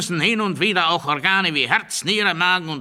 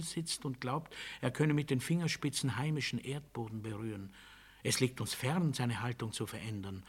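much softer and lower, a steady hush; 6 semitones lower, 8 dB quieter than usual. Acted. Interrogator quietly explaining his methods, soft and low. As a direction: murmur it as if leaning in close.

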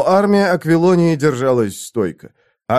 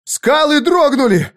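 high, drawn-out panicked cry; shrieked; 10 semitones higher, slower than usual. A narrator's reading. Squire sees the cliffs shake, shrieking. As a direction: shriek it far above your speaking pitch. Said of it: "взвизгнул Сквайр".

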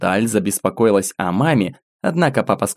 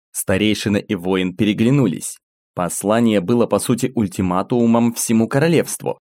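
plain reading voice

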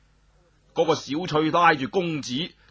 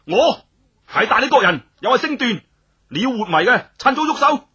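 loud, high and bold; defiant loud challenge; loud defiant declaration; 6 semitones higher, 7 dB louder than usual. Acted. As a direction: shout your name at her, daring her. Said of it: "于是佢大声话".

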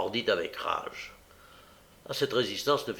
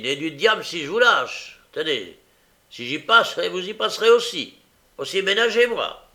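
angry; 6 semitones higher, 8 dB louder than usual. A narrator's reading.

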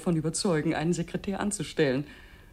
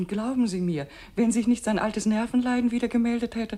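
urgent insistence; 7 semitones higher, 7 dB louder than usual. Acted. Worried wife insisting.

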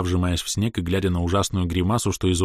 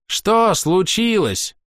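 slow, high, proud question; raised ringing voice; 13 semitones higher, 5 dB louder, much slower than usual. A narrator's reading. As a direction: lift the voice, drawn out. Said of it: "повысил звенящий голос".

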